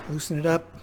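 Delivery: ordinary delivery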